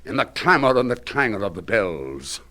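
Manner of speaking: ordinary delivery, nothing marked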